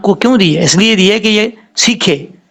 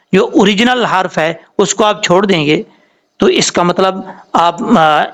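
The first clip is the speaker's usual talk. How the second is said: ordinary talk, nothing marked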